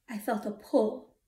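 ordinary delivery

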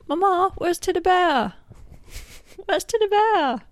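put-on very high, loud child calling for Mama; child character voice. Child calling Mama about a lost toy, high character voice. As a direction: wail it in a Southern-drawled little kid voice. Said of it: "not quite as high as the, as a child who owns".

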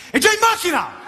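high, quick hammed-up waiter reply; eager Milan waiter. Milan waiter answers the customer's coffee order, high eager mock reply. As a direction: promise the coffee before it's even made. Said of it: "il cameriere dice".

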